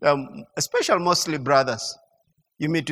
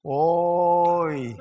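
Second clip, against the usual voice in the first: long drawn-out Central-style "Oi"; Central regional exclamation; 4 semitones lower, 4 dB quieter than usual. Retold or imitated. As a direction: stretch the Oi as people from Central do. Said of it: "let me say like the way we say in".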